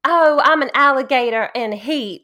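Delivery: loud mock Southern exclamation; hammed-up Southern saying, pitched high; exaggerated Southern. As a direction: put on a loud, playful Southern voice. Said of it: "these exaggerated Southernisms".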